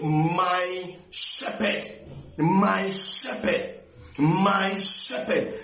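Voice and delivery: "My" leaned on, "shepherd" drawn out slowly; slow, savoring "My shepherd" each time; stress on my. Real, not acted. Preacher pressing the congregation to make it personal, slow stressed "My". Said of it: "preacher repeats for emphasis".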